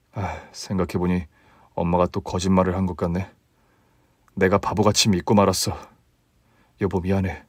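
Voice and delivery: a husband admitting he was fooled; low, soft apologetic husband voice; sheepish husband. Acted.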